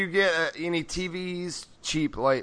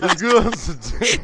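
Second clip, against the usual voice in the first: mock clip voice; 4 semitones higher, 5 dB louder than usual. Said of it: "repeating misheard clip".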